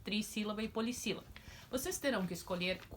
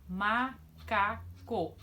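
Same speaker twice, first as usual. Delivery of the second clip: Macaco stretched very slowly, ma-ca-co; syllables drawn out; much slower than usual. Shown as a demonstration. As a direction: sound out Macaco beat by beat. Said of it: "três emissões de voz".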